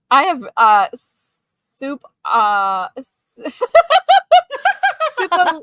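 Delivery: halting American attempt at French, breaking into laughter; best American accent. Shown as a demonstration. Guest tries onion soup on request, halting American accent. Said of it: "give me your best American accent".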